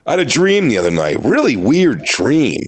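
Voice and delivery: overhyped campy impressionist setup, swooping pitch; milk the setup, drawing out the last words; hammy stage-comic lead-in; campy delivery. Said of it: "he even does it such a campy delivery".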